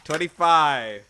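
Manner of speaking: loud, high disbelieving echo; astonished repeat with a laugh; amazed. Real, not acted. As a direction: marvel at the roll. GM reacts to big roll.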